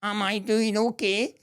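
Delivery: high, slow little voice asking for approval; timid cartoon figure. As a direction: play the small puppet peering up at the stern face. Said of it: "he's looking up and saying".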